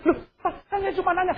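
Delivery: high, soft, hurried wifely protest; small high voice, rapidly pleading. Acted. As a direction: imitate a meek wife defending herself. Wife answers in acted quarrel.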